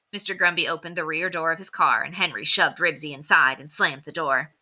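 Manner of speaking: usual speaking voice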